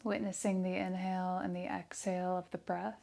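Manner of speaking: soft, hushed meditation voice with long pauses; guided meditation. Real, not acted. Yoga teacher leading breath awareness and affirmations, soft meditation voice. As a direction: guide them softly into stillness, leaving space after each phrase.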